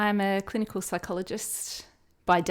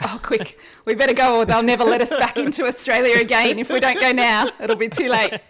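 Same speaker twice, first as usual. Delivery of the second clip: mock panic; 4 semitones higher, 8 dB louder than usual. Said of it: "and we were like".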